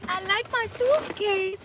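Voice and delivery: put-on high, slow, soft Slovakian-accented child voice; a lightly voiced little boy with a Slovak accent, drawn out; Barron Trump impression. Quoting Barron's clip in a high, slow child accent. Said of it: "That's what he says. With his little, Slovakian".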